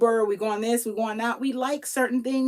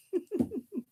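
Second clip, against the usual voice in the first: high giggle; 9 semitones higher, 8 dB quieter than usual. Real, not acted. Laughing at own joke.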